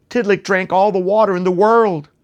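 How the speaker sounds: usual speaking voice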